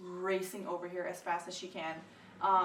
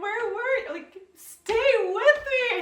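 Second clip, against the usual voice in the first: high, loud, drawn-out crying plea; crying out for husband to stay; desperate pleading cry; 14 semitones higher, 10 dB louder, much slower than usual. Retold or imitated. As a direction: beg him to stay, half crying. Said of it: "I told him, just like that".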